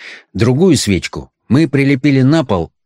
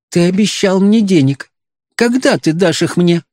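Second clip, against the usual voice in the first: put-on old woman voice; 8 semitones higher than usual. A narrator's reading. Old mother demands money.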